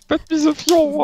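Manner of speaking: high squeaky coo; squeaky cutesy voice. Pretend. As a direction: squeak it like a cuddly pet. Mocks the cute voice back.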